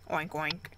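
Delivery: low, soft imitated oinks; pig oink. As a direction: grunt it like a little pig.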